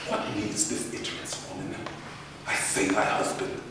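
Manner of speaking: low, hushed threat; menacing whisper. Acted. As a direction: hiss the threat quietly. Othello menacing.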